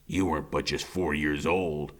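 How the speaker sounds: a hint of a cold killer speaking down to the boy; low menacing killer. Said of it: "he says".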